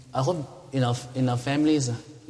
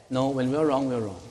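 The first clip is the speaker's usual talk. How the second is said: normal talking voice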